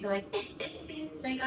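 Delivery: ordinary delivery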